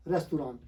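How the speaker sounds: "रेस्तुरां" in three syllables, res-tu-raan; long aa ending on रेस्तुरां; wrong pronunciation. Shown as a demonstration. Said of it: "तो ना तो ये रेस्तुरां है".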